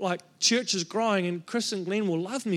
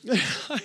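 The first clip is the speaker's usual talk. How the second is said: brief laugh. Real, not acted. Laughs at own rant.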